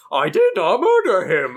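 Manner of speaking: loud, slow, overacted line reading; Andy's bad impression. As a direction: belt it out like a terrible impression. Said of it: "his way of delivering the line was literally like".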